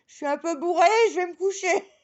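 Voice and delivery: very high, loud tipsy squeal; drunk confession. Retold or imitated. Tipsy woman telling the neighbour she's off to bed, very high. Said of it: "Je fais".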